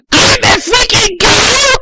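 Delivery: high, loud shriek; hammed-up panicked shriek; yelled. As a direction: shriek it in horror. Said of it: "I yelled".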